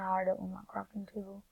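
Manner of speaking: usual speaking voice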